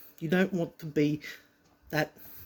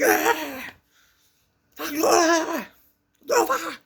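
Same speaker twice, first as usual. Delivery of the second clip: very high whooping cries; amazed screams; 13 semitones higher, 4 dB louder, slower than usual. Retold or imitated. Big kids seeing him land it, high astonished shrieks. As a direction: imitate a crowd screaming in amazement. Crowd as he lands the jump.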